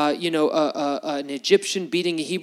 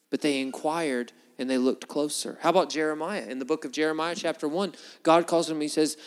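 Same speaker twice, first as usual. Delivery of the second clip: usual speaking voice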